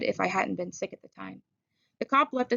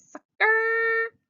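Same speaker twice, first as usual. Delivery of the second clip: much higher scornful hiss; 14 semitones higher, 5 dB louder than usual. Acted. Dally sneering at the departed policeman, hissed scorn. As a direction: sneer it after the cop. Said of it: "I heard Dally hiss".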